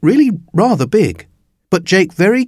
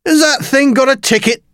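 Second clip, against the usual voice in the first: high, put-on official's voice; indignant ticket inspector; 13 semitones higher than usual. A narrator's reading. Inspector questioning Jake about his dinosaur, high indignant demand. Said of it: "said the ticket inspector".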